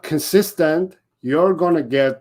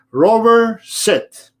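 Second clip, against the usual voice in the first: single firm call of the name, high and loud, then sit; crisp dog command; 7 semitones higher, 4 dB louder, slower than usual. Shown as a demonstration. Trainer showing the owner the right command, firm and clear. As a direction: give one clean, confident command. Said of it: "you have to say".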